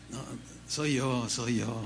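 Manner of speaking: soft, low and gentle, as a patient Lord; quietly reassure, almost smiling; a hint of a tender Lord; gentle patient reply. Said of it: "así como".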